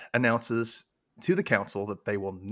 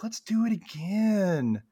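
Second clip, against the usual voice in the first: high, slow teasing invite; mock seductive; 10 semitones higher, much slower than usual. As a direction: coo it like a hammed-up flirt. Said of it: "She was like".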